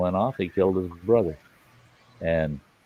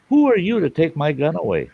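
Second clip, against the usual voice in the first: put-on indignant young man; 9 semitones higher than usual. Indignant quoted brother-in-law.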